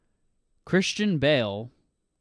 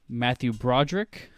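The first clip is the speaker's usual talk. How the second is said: ordinary talk, nothing marked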